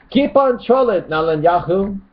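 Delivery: high put-on jeer; mock critic's voice. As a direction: jeer it high like an annoyed commenter. Reads out a critic's comment.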